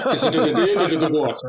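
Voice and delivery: a hint of Arnie delivering a quip; Arnie impression. Doing the Arnie line as a running joke, laughing. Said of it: "I'm like".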